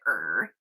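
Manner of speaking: low little growl sound; mock growl. Imitating the mother dog's low growl. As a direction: grumble like the puppy. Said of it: "she's like".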